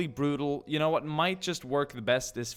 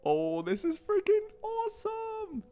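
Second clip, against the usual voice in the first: thrilled, much higher shout; excited. Real, not acted. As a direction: burst out with delight. Thrilled reaction to gameplay.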